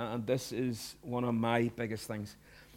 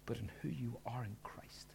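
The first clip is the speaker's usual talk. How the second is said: hushed, intimate whisper; 3 semitones lower, 13 dB quieter than usual. Real, not acted. Pastor whispering the heart of it to the church. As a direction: lean in and whisper the key truth. Key point dropped to whisper.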